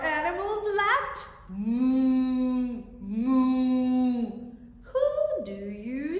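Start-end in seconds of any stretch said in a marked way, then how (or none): 1.5-4.2 big booming moo; loud cow moo. Shown as a demonstration.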